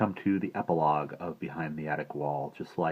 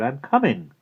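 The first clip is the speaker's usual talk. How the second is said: raised, loud call back; shouted; 8 semitones higher, 7 dB louder than usual. A narrator's reading. Maggie tells her aunt she's on the way, shouting. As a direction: call back so downstairs hears. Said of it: "she shouted".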